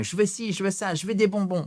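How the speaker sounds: mock child asking for sweets; demanding child. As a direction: nag like a kid who wants sweets. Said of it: "comme un enfant, qui réclame".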